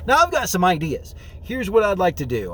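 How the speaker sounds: ordinary delivery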